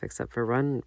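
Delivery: ordinary delivery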